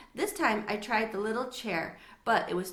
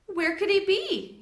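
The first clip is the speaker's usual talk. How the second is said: worried; 10 semitones higher, 6 dB louder, slower than usual. Acted. Father searching worried.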